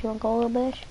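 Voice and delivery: ordinary talk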